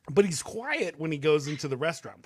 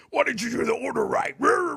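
louder, higher, hammed-up angry shouting; mock angry rant; 8 semitones higher, 6 dB louder than usual. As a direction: rant at the restaurant staff in a mock tantrum. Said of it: "I'm, like, all mad".

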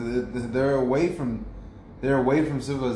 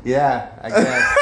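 laughing; 3 semitones higher, 8 dB louder, much slower than usual. Real, not acted. Friend laughing at bee joke.

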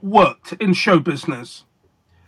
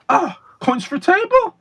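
put-on old master quizzing, much higher; Slidini impression; 11 semitones higher than usual. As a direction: squeak up high like the curious maestro. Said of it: "I'll give you my Slidini impression".

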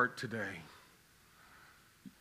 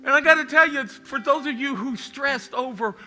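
higher and louder, climbing preacher's cry; full-force preaching; 7 semitones higher, 8 dB louder than usual. Acted. Pastor urging the congregation, in rising full-force preaching. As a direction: preach it out, lifting the room.

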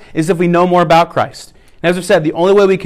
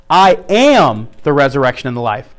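am lifted and lengthened; stress on am; 5 semitones lower than usual. Shown as a demonstration. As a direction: lean into am. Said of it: "just emphasize each one".

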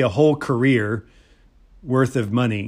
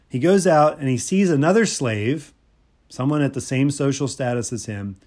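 ordinary talk, nothing marked

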